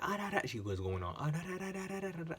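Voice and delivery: mimicking an angry coworker's rant, high-pitched mock babble; mocking her ranting. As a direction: parody her ranting as meaningless noise. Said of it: "And she's like".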